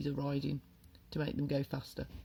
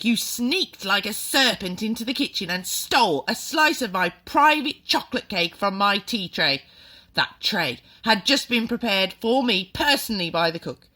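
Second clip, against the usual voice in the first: furious headmistress tirade; 5 semitones higher, 9 dB louder than usual. A narrator's reading. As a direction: rage about the stolen cake. Trunchbull's tirade about the cake.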